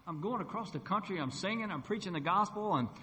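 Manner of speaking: high, pleading complaint; self-pitying. Retold or imitated. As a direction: re-enact a pity party to God. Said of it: "you ever had a pity party?".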